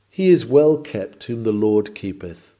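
normal talking voice

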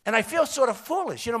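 usual speaking voice

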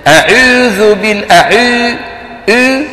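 أعوذ with a French-u 'ü' for the و, high pitched; واو مخلوطة بالياء. Shown as a demonstration. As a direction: mimic أعوذ fronting oo towards ee, drawn out. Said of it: "خلط صوتها بشيء من صوت الياء". (أعوذ بواو مائلة إلى الياء)

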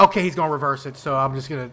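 normal talking voice

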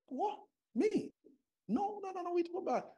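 high, startled protest; shocked denial. Retold or imitated. Accused by the feared man, pitched high in alarm. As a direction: squeak out a panicked denial.

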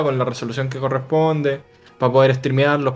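ordinary talk

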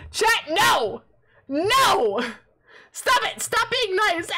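high, loud cries of protest; exasperated protest. Real, not acted. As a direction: beg them to stop, worked up. Pleading with chat to stop.

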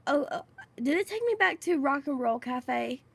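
ordinary delivery

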